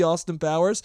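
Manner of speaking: ordinary talk, nothing marked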